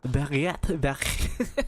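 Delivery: "Baguette" in a put-on French accent; half-joking French accent, rising at the end; attempted French accent. Shown as a demonstration. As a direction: try selling it in mock French. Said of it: "you're trying to sell a baguette".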